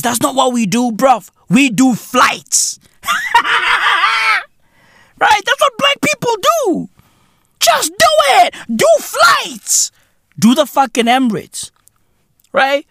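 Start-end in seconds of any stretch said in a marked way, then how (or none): 3.0-4.4 high shrieking laugh. Real, not acted. Vlogger cracking up at his own joke, high laugh. 5.2-9.9 comic high voice. Pretend.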